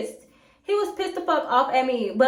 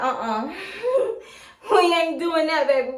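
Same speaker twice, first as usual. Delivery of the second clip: laughing; 3 semitones higher than usual. Retold or imitated. Refusing him, laughing.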